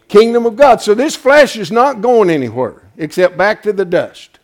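loud, rising pulpit cry; full-force sermon delivery; fiery preaching. Acted. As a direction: preach it out to the whole church.

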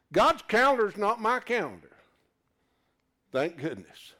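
ordinary delivery

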